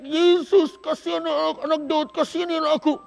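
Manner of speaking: seruan keras dan tinggi; crying out loud. Acted. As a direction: berteriak minta belas kasihan. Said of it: "Bartimus tidak berte-, berteriak". (Bartimeus berseru keras kepada Yesus)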